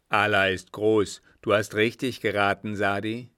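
sheik's loud, emphatic outburst; loud exclamation. Acted. Sheik confirming Sadi's guess about the Franks, loudly exclaiming. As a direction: proclaim it forcefully.

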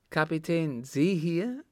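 high, rising exclamation; surprised call. A narrator's reading. Rallion unexpectedly meets the captain, surprised call. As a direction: call out in surprise, pitch climbing. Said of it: "rief der Letztere".

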